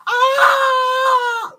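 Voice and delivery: very high, loud squeal of excitement; imitated excited scream. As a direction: shriek like a thrilled friend. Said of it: "like that in excitement".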